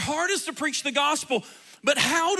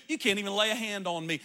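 quiet; 5 semitones lower, 8 dB quieter, faster than usual. Retold or imitated. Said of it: "and so he knew".

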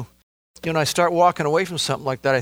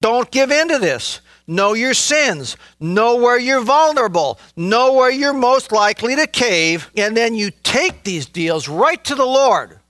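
raised, urgent preaching voice; 7 semitones higher, 4 dB louder than usual. Acted. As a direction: press it on the flock like a pulpit call. Preacher urging the congregation.

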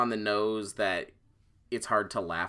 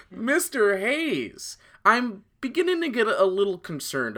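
mock stern voice; 11 semitones higher, 5 dB louder than usual. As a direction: play the concerned doctor. Mock formal concern at co-host.

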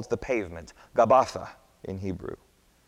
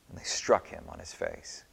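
usual speaking voice

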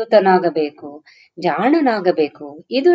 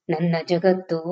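usual speaking voice

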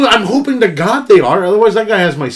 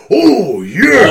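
drawn-out wrestler's yell, higher and louder; Randy Savage; 4 semitones higher, 4 dB louder than usual. Retold or imitated. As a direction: imitate a wrestler roaring a catchphrase. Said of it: "I'm just gonna hear him go".

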